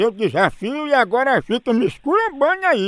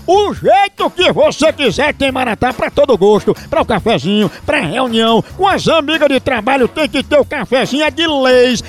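ad read; 4 semitones higher, 5 dB louder than usual. Acted. Host reads coffee ad.

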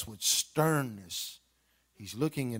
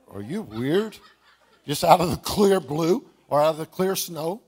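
louder mock wife asking if he's weird; wife's mock incredulous retort; 4 semitones higher, 8 dB louder than usual. Husband retells wife's baffled reply, louder and mocking. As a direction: play the puzzled wife, teasing. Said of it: "she looked at me like".

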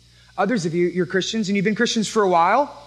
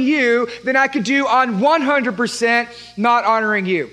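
higher, louder impassioned prayer to God; fervent prayer; 7 semitones higher, 5 dB louder than usual. Acted. Pastor voicing a tither vowing to God, impassioned. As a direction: pour it out like a vow to God. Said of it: "The tithe says".

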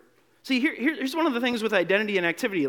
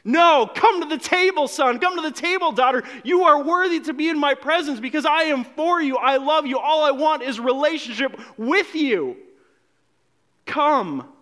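the Father inviting; 7 semitones higher, 4 dB louder than usual. Acted. Preacher voices the Father calling.

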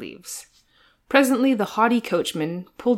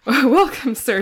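hearty welcome, a bit higher and louder; good-natured exclamation; 6 semitones higher, 6 dB louder, slower than usual. A narrator's reading. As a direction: greet the guest with a cheerful burst. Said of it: "he exclaimed good-naturedly".

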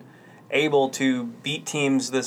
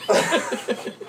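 loud high giggling; laughing through the words; 7 semitones higher, 4 dB louder than usual. Real, not acted. Giggling loudly.

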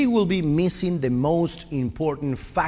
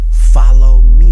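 much louder, bellowed out; booming call; 3 semitones lower, 19 dB louder, slower than usual. Acted. Preacher calling the congregation to follow. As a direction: call it out across the hall. Preacher repeats Jesus' invitation loudly.

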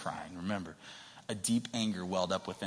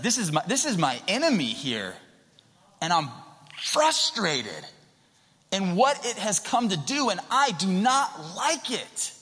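raised, higher voice of angry frustration; mad and frustrated; 8 semitones higher, 5 dB louder than usual. Acted. Preacher acts out Jesus facing his enemy, mad and frustrated. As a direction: seethe in a loud, high voice, hitting the key words. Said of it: "he's frustrated and he's mad".

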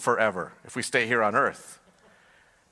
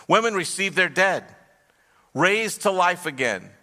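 preaching at full force; 5 semitones higher than usual. Acted. Preacher reading Hebrews to the congregation, full preaching force. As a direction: build each phrase like a roll call of heroes. Reads scripture list at force.

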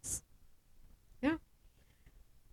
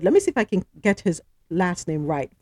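ordinary delivery

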